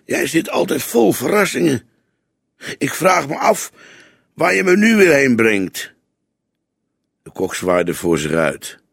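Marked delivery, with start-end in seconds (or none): 0.1-5.9 lightly voiced young sidekick, mildly grumbling; a hint of a disgruntled junior partner, pitched higher; grumbling young detective. A narrator's reading. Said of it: "De jonge regisseur bromde".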